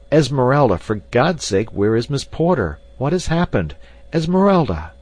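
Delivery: loud, high urgent calls; frantic shouting. A narrator's reading. Clayton shakes the unconscious maid to wake her, frantic shouting. Shaking unconscious woman, desperate.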